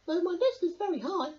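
high bird voice, lighter; high chirpy bird. A narrator's reading. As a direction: add it as a small bird. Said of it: "trilled Bird".

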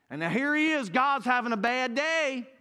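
loud, high, stretched-out exclamation; preacher's exclamation. Acted. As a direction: cry it out to the back of the church. Dramatizing God's bad day to congregation.

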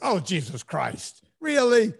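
mock dread turning to disbelief; hammed-up inner reaction to a friend's book; exasperated disbelief. Inner reaction retold again.

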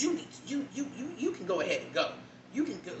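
imitating a curt, dismissive coworker; a touch of impatient brush-off; lightly voiced snapping coworker; mock snappy coworker. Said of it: "This person snaps at me".

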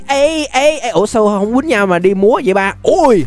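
ordinary delivery

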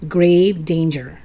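ordinary delivery

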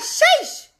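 very high-pitched, exasperated exclamation; exasperated sheesh. Real, not acted. Coach fed up that followers won't wake up, high exasperation. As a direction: let it leap up high, then fall.

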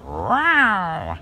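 high squealed wow; excited wow. Real, not acted. Car fan to viewers about the Buick's front, high delighted wow.

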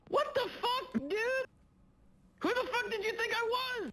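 outraged disbelief. Real, not acted. Outraged at friend.

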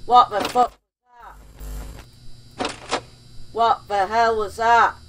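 a sudden startled outburst. Real, not acted. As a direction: jump at the screen and blurt it out. Startled by something in game.

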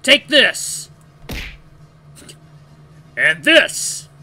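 loud, high fighting shouts; battle cry. Acted. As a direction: yell each blow.

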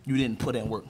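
calm sincere. Real, not acted. Rapper calming his rival before the battle, low and sincere. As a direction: ease off and speak low and sincere.

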